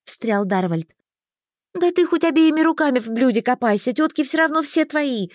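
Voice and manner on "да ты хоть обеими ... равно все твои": high-pitched sulky retort; peeved, pitched-up grumble; grumbling. A narrator's reading. Heroine retorts to the admired mage, grumbling. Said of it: "буркнула я".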